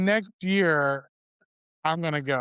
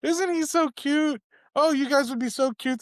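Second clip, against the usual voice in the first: gushing matchmaker mom; 9 semitones higher than usual. Retold or imitated. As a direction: coo over him like a proud mother. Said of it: "is like".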